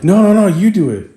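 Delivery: high, loud mock panic; panicked refusal. Partner trying to get out of car, panicked refusal. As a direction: imitate a partner desperate to back out. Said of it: "She's trying to get out of the car".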